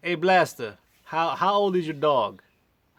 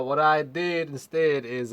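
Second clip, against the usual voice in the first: normal talking voice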